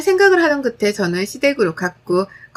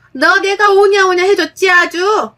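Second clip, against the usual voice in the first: higher, slow reproach; angry scolding; 7 semitones higher, 4 dB louder than usual. Acted. Mother-in-law reproaches spoiled daughter-in-law, slow angry. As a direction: drag it out with indignation.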